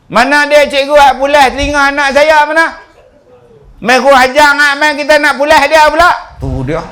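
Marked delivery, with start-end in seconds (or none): 0.1-2.7 high and loud, demanding; angry mother. Acted. Angry mother shouting at the school for her child. 3.8-6.4 high and loud, demanding; angry mother. Acted. Angry mother shouting at the school for her child.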